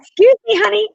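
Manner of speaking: very high, loud playful indignation; sassy squeal, hamming up the offence; mock indignant comeback. Wife putting husband in his place, mock indignant. Listener playacts the wife's comeback.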